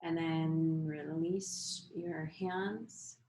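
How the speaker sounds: guided meditation. Acted. Leads breathing practice.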